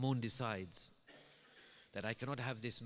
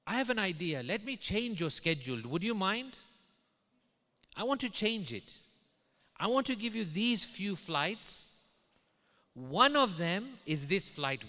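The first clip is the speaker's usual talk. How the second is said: lightly voiced friend, raised pitch, emphatic; friend's eager proposal; 6 semitones higher, 5 dB louder than usual. Friend offers the pilot a flight, eager and emphatic.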